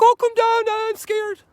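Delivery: very high, shrill-free mock mom voice, loud at first; fretful mother. A worried mother calling her child down off the rock, very high mock voice. Mother fretting at climbing kid.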